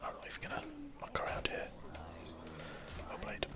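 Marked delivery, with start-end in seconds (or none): none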